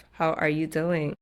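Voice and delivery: ordinary talk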